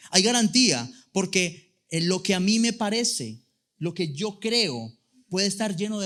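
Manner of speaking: loud, high-pitched fervent preaching; preaching at full force. Real, not acted. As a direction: drive the point home to the congregation. (predicación a todo pulmón)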